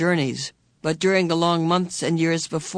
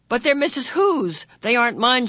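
much higher, urgent insisting; urgent explaining; 7 semitones higher, 4 dB louder than usual. A narrator's reading. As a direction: press him to understand. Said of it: "she explained".

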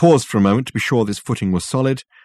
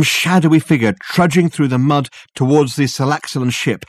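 plain reading voice

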